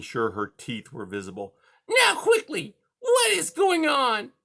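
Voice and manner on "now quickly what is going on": high, loud interrogating cat; high-pitched bullying villain; menacing cat. A narrator's reading. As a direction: demand an answer in a piercing high voice. Cat interrogates trapped mouse.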